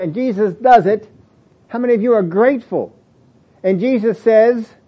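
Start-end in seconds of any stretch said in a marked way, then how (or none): none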